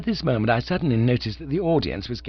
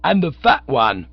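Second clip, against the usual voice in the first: loud, high soapbox jeer; ranting orator; 6 semitones higher, 8 dB louder than usual. A narrator's reading. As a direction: point him out to the mob. Orator continues the harangue.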